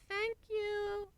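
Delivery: very high tiny foal thanks; sleepy foal thanking the mare; baby voice. A narrator's reading. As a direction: squeak it like a baby.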